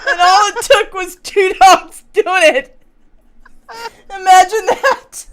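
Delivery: high, loud, tickled delivery; highly amused. Real, not acted. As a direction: crack up as the line lands. Retelling the joke amid laughing fit.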